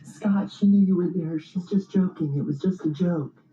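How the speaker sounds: high, pleading friends. Retold or imitated. Friends pleading with Scott to save her, high. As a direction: beg like friends saving their girl. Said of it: "they're all like".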